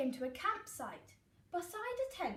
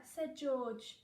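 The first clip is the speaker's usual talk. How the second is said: ordinary delivery, nothing marked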